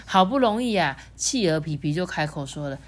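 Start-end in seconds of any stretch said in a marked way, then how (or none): none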